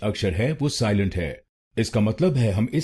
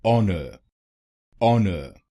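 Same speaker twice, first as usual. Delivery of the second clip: ऑनर with the weight on ऑ; stress on first syllable; much slower than usual. Shown as a demonstration. Teacher showing where the stress falls in ऑनर. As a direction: lean on the ऑ of ऑनर, the नर light. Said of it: "पहले शब्दांश पर जोर डालना है".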